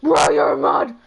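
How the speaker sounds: deep, loud fake-crying bro; hammed-up pleading to the mod; mock wailing plea. Streamer retelling pleading with a mod to act, wailing. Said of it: "I was like".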